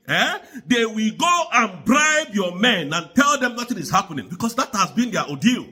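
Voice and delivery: ordinary talk, nothing marked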